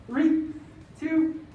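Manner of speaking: high, loud count-in to start the set; rally the class into the first rep; called-out countdown. Real, not acted. Instructor to home class, loud upbeat countdown.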